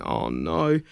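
soft, deflated oh no; quiet groan. Retold or imitated. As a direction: let it sink under your breath. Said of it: "And I just went".

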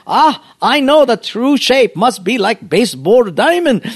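high, loud mock Japanese accent; mock bonsai master. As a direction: exclaim a great revelation in broken English. Mocks pastors in the master's voice.

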